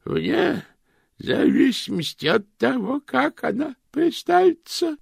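put-on high, slow, cracked old lady. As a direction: croak like a tiny ancient aunt. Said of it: "уточнила Бережена Шик".

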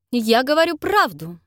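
curt retort. A narrator's reading. Miss Mercer cutting Cynthia off, curt and sharp. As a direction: snap back, end the argument. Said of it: "отрезала она".

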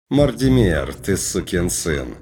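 loud call, then slow amused words; angel's booming address. Acted. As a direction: boom the name, then drawl with a hint of amusement. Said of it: "нотку веселья".

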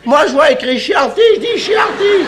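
high, loud insistence on "Chianti" said the French way; indignant shout. Acted. Diner insisting to his friend on French reading, indignant shout.

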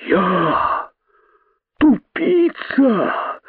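very high, drawn-out incredulous squeal; disbelief. A narrator's reading. Python not believing his ears, high slow incredulity. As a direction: stretch it out, soaring in disbelief. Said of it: "не веря своим ушам".

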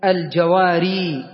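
الجواري said slowly, the final ي drawn out; drawn-out pausal form. Shown as a demonstration. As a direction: hold the final vowel of الجواري. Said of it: "عند الوقف نقول الجواري". (نطق الجواري ببطء مع مد الياء في الوقف)

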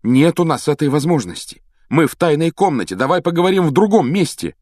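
high, loud, impatient urging; losing patience. A narrator's reading. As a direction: insist with rising impatience. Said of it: "Гарри начал терять терпение". (теряя терпение)

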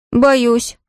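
put-on small high-pitched child's confession; boy admitting fear. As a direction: admit it as a scared son. Мальчик признаётся папе. (мальчик признаётся в страхе, высоким голосом)

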